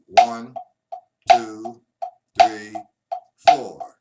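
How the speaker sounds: every count shouted loud; loud counting on every beat. Shown as a demonstration. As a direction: bark out each beat like a drill. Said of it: "to hit the accent".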